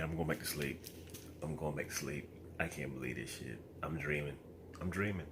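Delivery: soft deadpan muttering to himself; defeated mutter. Real, not acted. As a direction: mumble it like giving up.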